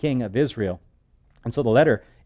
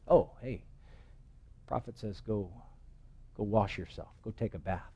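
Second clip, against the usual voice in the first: much softer, tossed-off servant voice; offhand servant; 12 dB quieter than usual. Acted. Servant carrying the prophet's order to the general, offhand and much softer. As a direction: toss the message off casually, almost under the breath.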